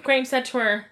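ordinary talk

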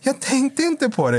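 very high, slow mock voice; imitated girlfriend's excuse. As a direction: mimic her helpless excuse in falsetto.

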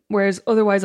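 ordinary talk

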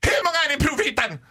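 high, rapid staff shout; mock screeching. Shop assistant checking the fitting room, mock screech. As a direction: fire it out high and fast. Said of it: "Skriker de bara".

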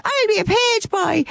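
high chirpy puppet voice; Bosco puppet voice. Retold or imitated. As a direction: announce it proudly like a puppet. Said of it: "He goes".

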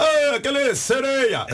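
mocking quote. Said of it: "Quando fala".

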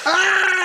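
high gurgling mock death scream; scream, then gurgle as if choking on blood. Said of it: "that was a good gurgle there".

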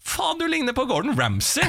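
high, laughing shock at a celebrity; stunned drunk spotting a TV chef; starstruck outburst. Retold or imitated. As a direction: blurt it out in disbelief, laughing. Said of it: "ser han jo Gordon Ramsay og bare".

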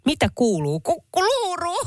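high, sing-song local tourist-seller voice; imitating a local. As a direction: chirp it like a street vendor. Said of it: "opettamatta paikalliselle sanontaa". (matkii paikallista myyjää)

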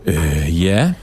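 slow, reluctant yes; hesitant, drawn out. Real, not acted. Cagey guest admitting his hometown to radio hosts, hesitant and drawn out. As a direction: drag out a reluctant answer. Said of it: "du trækker lidt på det".

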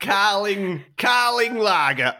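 put-on slow, drawn-out mystery accent on Carling lager; unplaceable put-on accent. Naming his lager for the show, in a put-on accent. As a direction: ham up an unknown accent, slowly. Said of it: "I don't know what this accent is".

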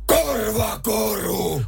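drawn-out high roar, slow; roaring shout. Shown as a demonstration. Co-host showing what he would roar in the contest, slow bellow. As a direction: bellow it, stretching every syllable. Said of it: "Niin sit mä voisin huutaa vaikka".

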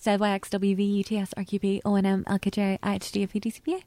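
alphabet rattled off fast. Shown as a demonstration. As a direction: race through the letters. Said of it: "now you can hear it fast".

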